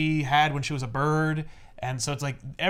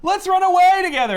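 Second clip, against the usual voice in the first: much higher, loud swooning plea; hammed-up lover; 9 dB louder than usual. [name] begs [name] to run away, high and swooning. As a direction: gush it like a lovesick hero. [name]'s romantic proposal voiced.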